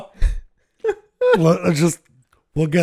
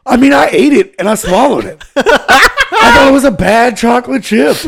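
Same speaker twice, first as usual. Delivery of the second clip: laughing; 7 semitones higher, 7 dB louder than usual. Real, not acted. Laughing retelling.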